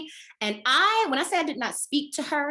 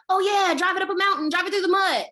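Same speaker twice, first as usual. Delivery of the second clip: hyped-up ad announcer, higher; mock commercial voice; 6 semitones higher than usual. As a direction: parody a Jeep commercial with eager pitch. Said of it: "they're like".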